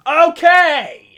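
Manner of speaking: a loud, sudden, high "okay!"; surprised shout. Real, not acted. Reading the article aloud and hitting "Kim Ye", loud surprised shout. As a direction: react out loud to the odd name "Kim Ye". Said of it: "Didn't read that this morning".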